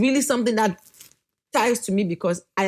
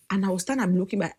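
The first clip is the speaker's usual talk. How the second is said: ordinary delivery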